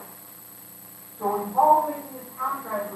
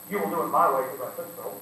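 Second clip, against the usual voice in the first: lower, quick, domineering order; bossy command; 7 semitones lower, faster than usual. Acted. Preacher acting someone ordering another around, low and curt. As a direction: lay down the law.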